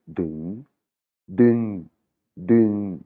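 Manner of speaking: « ding » bref et net; voyelle courte thaïe. Shown as a demonstration. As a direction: coupe « ding » court, en thaï. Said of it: "une voyelle courte avec le son E". (prononciation thaïe, voyelle brève)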